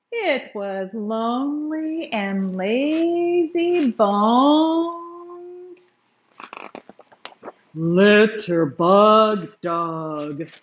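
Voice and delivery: drawn out, weighty naming of the villain; slow down and land the name Litterbug Doug; slow dramatic reveal. A narrator's reading. Storyteller unveiling the villain to listening children, slow and weighty.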